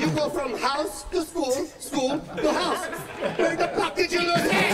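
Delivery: high, exasperated mother's grilling; interrogating mom. Retold or imitated. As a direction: spell it out, then explode. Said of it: "Two and a half hours of yelling".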